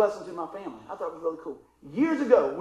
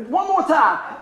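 higher, louder mock request; retold loud request; 7 semitones higher, 6 dB louder, slower than usual. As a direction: call it out as the mechanic. Retold mechanic asking for a repeat.

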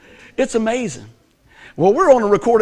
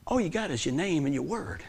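softer dad voice; 4 semitones lower, 12 dB quieter than usual. Retold or imitated. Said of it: "He says".

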